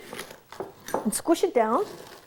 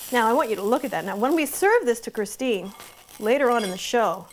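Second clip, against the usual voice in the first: normal talking voice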